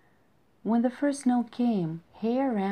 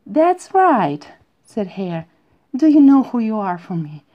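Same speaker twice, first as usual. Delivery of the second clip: joyful outburst; 3 semitones higher, 11 dB louder than usual. A narrator's reading. Hare's joyful declaration.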